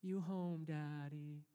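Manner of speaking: subdued, lightly voiced waking child; sleepy child. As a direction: imitate a half-woken little boy, gently. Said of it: "if he wakes up in that moment".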